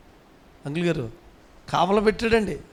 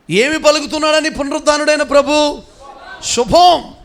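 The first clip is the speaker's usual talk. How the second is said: high, loud rhetorical proclamation; full-force preaching; 6 semitones higher, 6 dB louder than usual. Acted. Preacher announcing the risen Lord's word, loud and high. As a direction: ring it out to the whole congregation.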